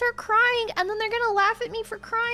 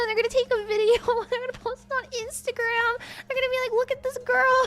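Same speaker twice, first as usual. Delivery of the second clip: high-pitched, breaking into laughs; laughing; 5 semitones higher than usual. Real, not acted. Telling of being filmed and mocked, laughing. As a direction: laugh through the mockery as it escalates.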